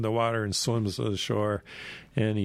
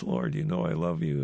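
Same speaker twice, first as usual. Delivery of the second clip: mildly grieved, higher-pitched retold plea; 5 semitones higher than usual. Peter is asked the third time, mildly grieved. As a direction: voice a hurt protest in a higher pitch. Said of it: "it says Peter is grieved".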